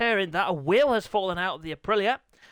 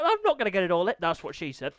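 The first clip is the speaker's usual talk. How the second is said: ordinary talk, nothing marked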